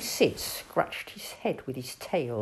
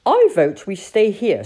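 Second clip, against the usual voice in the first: loud, punchy captain voice; hearty captain; 3 semitones higher, 11 dB louder than usual. Acted. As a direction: speak up as a keen animal captain. Voices Captain Beaky in dialogue.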